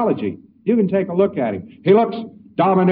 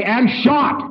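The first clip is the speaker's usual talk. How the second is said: eager agreement; 7 semitones higher than usual. Retold or imitated. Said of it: "and you say".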